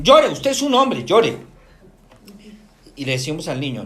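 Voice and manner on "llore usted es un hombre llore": loud, high, drawn-out command; insistent order, played up for the audience; emphatic urging. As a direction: urge him forcefully, pitch jumping up. Acted order to a man to cry.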